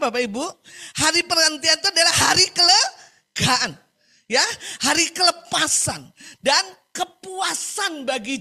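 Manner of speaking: high, loud preaching voice; emphatic preaching. Real, not acted. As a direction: drive each key word home to the congregation. Preaches on the day of rest.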